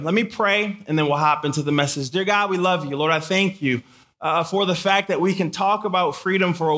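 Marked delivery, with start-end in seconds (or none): none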